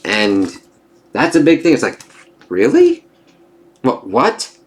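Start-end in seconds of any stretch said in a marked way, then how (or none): 2.5-4.6 incredulous. Real, not acted. Reviewer shocked by Otto's line, incredulous. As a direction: shoot way up in disbelief. Reader's shocked reaction to Otto.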